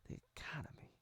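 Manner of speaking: breathy whispered aside; whispering. Real, not acted. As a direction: whisper it under your breath. Whispered aside about economy.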